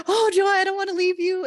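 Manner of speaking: high, loud, voice breaking into a cry; tearful plea. Retold or imitated. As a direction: beg her not to go, nearly sobbing. Not wanting to leave guide.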